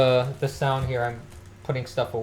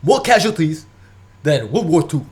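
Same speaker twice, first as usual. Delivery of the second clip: loud gruff doom-saying rant; proper Bernie Sanders impression, loud; hammed-up Bernie Sanders, louder; 7 dB louder than usual. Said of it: "I gotta do the voice".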